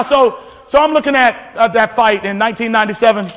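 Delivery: ordinary delivery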